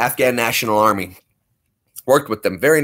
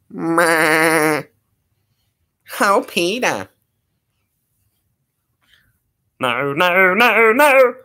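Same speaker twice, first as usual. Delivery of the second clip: higher, rising cartoon mom; Lois impression; 7 semitones higher, much slower than usual. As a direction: call out to Peter. Family Guy impressions.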